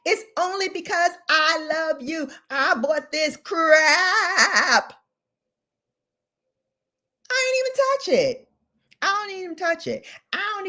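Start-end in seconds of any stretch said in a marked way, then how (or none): 2.5-10.7 mock sob. Mock crying over purchase.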